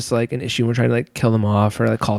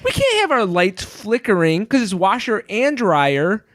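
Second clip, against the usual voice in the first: normal talking voice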